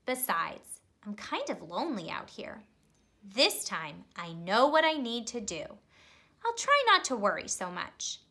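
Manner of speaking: put-on lively storybook narration for kids; expressive read-aloud for young listeners; reading to children. A narrator's reading. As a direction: read it like a bedtime picture book. Reads picture book to children.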